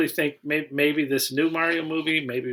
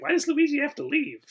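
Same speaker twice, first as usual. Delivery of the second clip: incredulous; 10 semitones higher than usual. Retold or imitated. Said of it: "And I'm just like".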